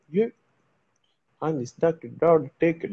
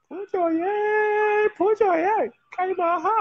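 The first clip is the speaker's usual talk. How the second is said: high call; 3 dB louder than usual. Real, not acted. Calling his friend to come for the blessing, high.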